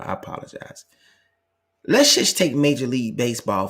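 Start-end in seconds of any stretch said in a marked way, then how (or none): 0.0-0.9 soft whispered aside; whispered apology. Real, not acted. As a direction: breathe it off mic. Aside while son causes trouble.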